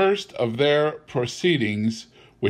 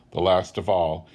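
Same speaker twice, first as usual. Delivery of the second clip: ordinary delivery, nothing marked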